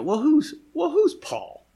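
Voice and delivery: mocking sneer pitched far up; his critics in Corinth sneering at Paul, scoffing; scoffing dismissal. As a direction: brush Paul off with contempt. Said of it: "You know".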